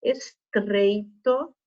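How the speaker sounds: very slow "Estreito" with an exaggerated R; forced R. Shown as a demonstration. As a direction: draw out Estreito, pushing the R. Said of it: "dando essa forçadinha no R". (R forçado em "Estreito", bem devagar)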